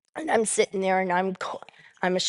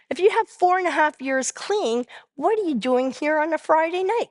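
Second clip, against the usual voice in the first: high-pitched incredulous challenge; retold disbelief, pitched well up; baffled questioning; 8 semitones higher than usual. Newcomer questioning a long-clean member, baffled questioning.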